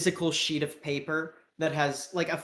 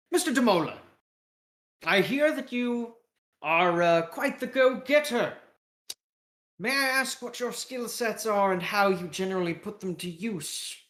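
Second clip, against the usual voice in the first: high, lilting British interviewer; British accent; 4 semitones higher than usual. Acted. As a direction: play a British caricature sizing up a recruit. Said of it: "with a British accent".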